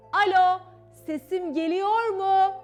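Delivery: very loud, high phone hello; shouted, drawn-out question; calling down the line. A narrator's reading. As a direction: test the first telephone at full voice. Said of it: "Ve ona ilk sesi".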